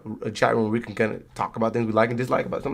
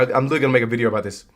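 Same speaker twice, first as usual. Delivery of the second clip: ordinary delivery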